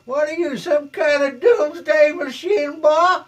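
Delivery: high, very loud Southern sheriff holler; slow, shouted Deep South lawman accent. Pretend. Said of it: "a deep South Sheriff accent".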